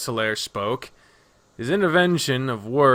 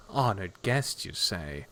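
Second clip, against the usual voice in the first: slow, soft echo with a scoffing laugh; skeptical echo; 4 semitones lower, 9 dB quieter than usual. Acted. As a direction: repeat it back softly, doubting the offer. Nadja echoes his words sceptically.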